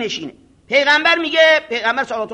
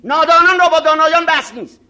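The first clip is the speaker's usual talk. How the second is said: louder, proud decree with weight on را; haughty shout; 8 semitones higher, 10 dB louder than usual. Retold or imitated. Arrogant cleric shouting down a young student, haughty and loud. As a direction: declare it loudly like a scornful scholar.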